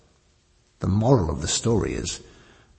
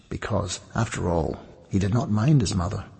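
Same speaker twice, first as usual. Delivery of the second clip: ordinary delivery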